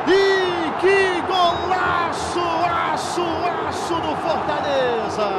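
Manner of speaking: long, slow shouted goal call; explosive goal commentary. Real, not acted. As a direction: stretch the goal shout out, full force. Goal scored, commentator erupts.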